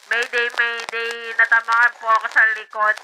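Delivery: loud, low mayday call; fast, punchy fake distress report; mock radio distress call. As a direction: play at calling mayday over a radio.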